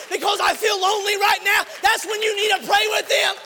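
loud, high impassioned shouting; preaching at full force. Acted. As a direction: build to a roaring altar call.

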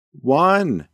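loud counting call; yelling. A narrator's reading. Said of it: "she yelled out".

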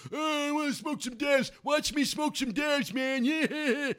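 high-pitched, singsong put-on stoner voice; mocking stoner impression. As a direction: mimic a dopey weed smoker, drawn out and high. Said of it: "where all he does is sit around and smoke weed".

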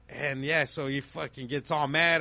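normal talking voice

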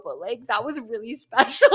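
breaks into giggles mid-line; giddy, laughing thanks; laughing while gushing. Real, not acted. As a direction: laugh through the words.

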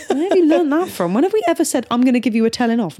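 high, incredulous questions; mock disbelief. Mother to partner, mock disbelief at daughter's words. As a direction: act baffled at where she learned it.

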